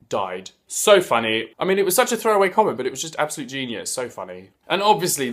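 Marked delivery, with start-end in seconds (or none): none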